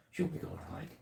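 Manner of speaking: high, hushed whisper teasing the dog; soft whisper to an eager puppy; excited whisper to dog. Real, not acted. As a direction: whisper it like a secret treat.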